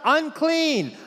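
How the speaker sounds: high, loud cry; ringing high-pitched yell; Isaiah yelling out. Acted. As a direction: cry it out like a prophet confronted by God's glory. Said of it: "he yells out".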